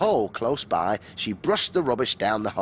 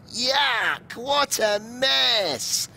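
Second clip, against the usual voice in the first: put-on high, slow childish cry of disgust; children shouting; 11 semitones higher, much slower than usual. A narrator's reading. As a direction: squeal it like disgusted little kids. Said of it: "shouted the children".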